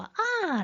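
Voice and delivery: 아 starting very high and plunging; Mandarin fourth tone. Shown as a demonstration. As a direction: throw 아 high, then let it fall. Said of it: "마지막 사 성".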